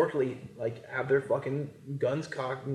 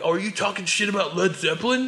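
mocking voice; 7 semitones higher than usual. Imagined accuser mocking his opinion.

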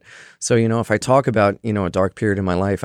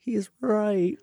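grudging admission; 10 semitones higher, 6 dB quieter than usual. Retold or imitated. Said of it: "went home and was like".

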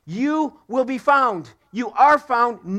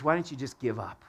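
low, quiet, taunting murmur, lower and much softer; discouraging inner voice; 11 semitones lower, 7 dB quieter than usual. Retold or imitated. Preacher voicing the inner voice that says quit, low and hushed. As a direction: voice the doubt that whispers give up. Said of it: "Those words".

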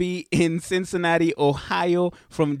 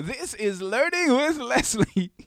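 re-enacted show intro, pitched up; sudden excitement; 8 semitones higher, slower than usual. Podcaster imitating his own intro to explain laughing, suddenly excited. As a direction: burst into the show's title, excited. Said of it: "say something all of a sudden excited".

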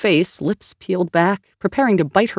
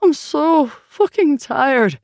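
much higher and very slow, voice cracking into tears; breaking into sobs; 9 semitones higher, 3 dB louder, much slower than usual. Acted. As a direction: let the exhaustion spill over into crying. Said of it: "broke down and began heaving with exhausted sobs".

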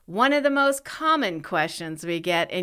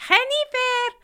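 put-on high-pitched Spanish-accented call of the name; mother-in-law calling out; 4 dB louder than usual. Imitating her mother-in-law calling her, high accented call. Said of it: "my mother-in-law, who called me Hennifer".

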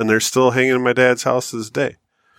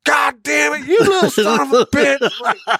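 high, slow, laughing curse; a hint of a cursing father, half laughing; dad cursing his son in mock anger while laughing; mock angry dad; slower than usual. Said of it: "He was pissed".

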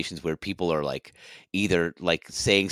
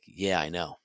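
smug reply; 6 dB quieter, slower than usual. Retold or imitated. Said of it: "And he's like".

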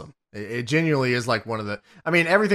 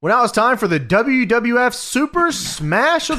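announcer read; 7 semitones higher than usual. Acted. Cohost reading the show's sponsored segment title, in a mock announcer voice.

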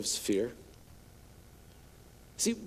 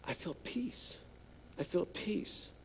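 put-on peaceful child; 15 dB quieter, slower than usual. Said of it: "you're like".

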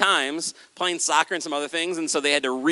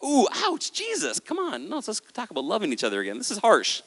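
much higher, wincing complaint; mock whining; 5 semitones higher, 5 dB quieter than usual. Listener pleading with Jesus to be nicer, high whiny protest. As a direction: play a stung listener protesting.

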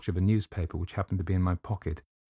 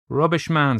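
loud, higher-pitched Irish dismissal; scornful Irish doctor; 8 semitones higher, 7 dB louder than usual. Acted. Doctor dismissing the narrator's refusal, loud scornful Irish voice.

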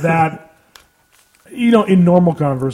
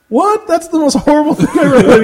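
retold shocked outburst, much higher, fast at the end; shocked reply; 9 semitones higher, faster than usual. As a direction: gasp it out as if appalled. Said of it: "I went".

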